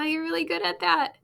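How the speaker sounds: ordinary delivery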